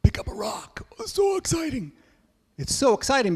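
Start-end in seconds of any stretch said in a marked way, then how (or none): 0.0-0.9 much higher whispered mock thrill; sarcastic whisper. Kids mocking the leaders, sarcastic high whisper. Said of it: "And they're like". 1.0-1.9 high, sarcastic gush; mock excitement. Kids mocking the hike rule, high sarcastic gush. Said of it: "And they're like".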